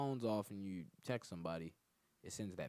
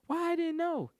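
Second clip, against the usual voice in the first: put-on much higher and louder whiny girl; mock girl voice; 12 dB louder than usual. A boyfriend mocking his girlfriend's excuse, high and whiny. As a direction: squeal it like a clueless girlfriend.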